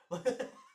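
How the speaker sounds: loud high laugh bursting out; sudden amused laugh; burst of laughter. Real, not acted. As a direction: crack up at the player. Laughing at confused player.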